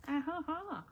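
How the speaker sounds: high, put-on chuckle; fake laugh. Shown as a demonstration. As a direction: laugh on purpose even if forced. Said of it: "You can put your".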